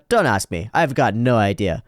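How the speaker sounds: ordinary delivery, nothing marked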